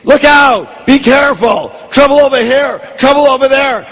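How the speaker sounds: high shouted alarm calls; alarmed shouting. Retold or imitated. The mind as sentry screaming warnings, high alarmed shouts. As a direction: shout warnings like a panicked lookout. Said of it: "so it's always screaming".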